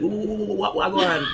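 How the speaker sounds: put-on high, loud excited Jamaican stammer, Jamaican accent. Actor showing the host how excited Jamaicans sound, high and loud Jamaican accent. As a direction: blurt it out stuttering in a Jamaican accent. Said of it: "The thing about the Jamaican accent".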